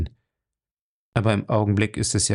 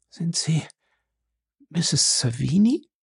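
weak voice; 6 semitones higher than usual. A narrator's reading. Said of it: "fragte sie dann mit schwacher Stimme".

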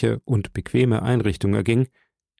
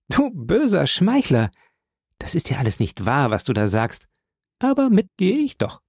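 lightly higher, teasing sweetheart voice; a young woman chiding her flatterer, higher; playful young woman; 5 semitones higher than usual. Acted. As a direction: scold him fondly, half laughing. Portia teasing her suitor.

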